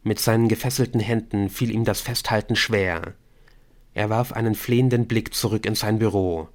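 ordinary delivery, nothing marked

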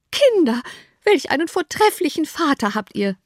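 high-pitched gushing exclamation; delighted cry. A narrator's reading. Mother praising the father to her children, delighted cry. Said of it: "rief die Mutter".

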